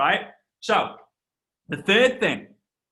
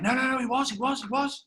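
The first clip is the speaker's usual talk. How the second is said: insistent dad; 7 semitones higher, 4 dB quieter than usual. Retold or imitated. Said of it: "And he was like".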